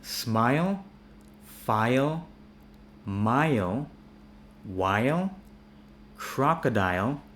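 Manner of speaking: smile, file, mile each as 'eye-ol', rising; full endings. Shown as a demonstration. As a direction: sound the L in while and crocodile. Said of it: "pronounce it full on".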